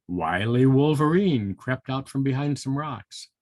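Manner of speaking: usual speaking voice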